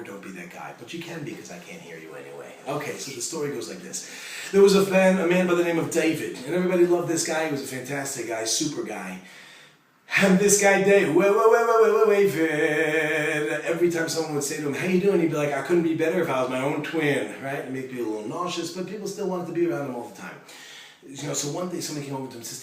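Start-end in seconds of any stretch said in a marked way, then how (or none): 4.5-9.2 big booming storyteller's call to the crowd; preaching at full force. Acted. 10.2-13.6 loud, high run of wait, wait; halting his own story to the crowd, loud and excited; excited interruption. Real, not acted. Speaker stops himself excitedly. 13.6-22.3 loud, driving sermon delivery; preaching at full force. Acted. Booming sermon storytelling.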